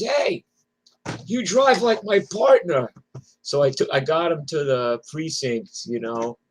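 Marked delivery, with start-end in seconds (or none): 0.0-2.9 much higher and louder, put-on voice; impressed cop. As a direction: call it out like he's thrilled by the ride. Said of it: "the guy goes".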